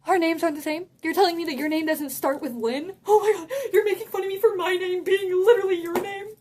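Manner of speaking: high-pitched, incredulous comeback; indignant disbelief. Retold or imitated. Girl confronts kid who mocked her name, incredulous. Retold comeback about the identical names.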